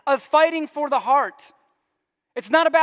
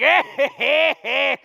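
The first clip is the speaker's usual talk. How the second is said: hooting and hollering; 4 dB louder, slower than usual. Retold or imitated. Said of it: "they're hooting and hollering".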